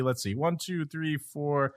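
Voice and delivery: normal talking voice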